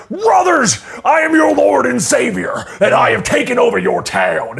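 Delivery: high, slow proclamation from a stage; preacher villain. Acted. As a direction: proclaim like a self-made savior to his flock. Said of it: "he takes to a stage and he's like".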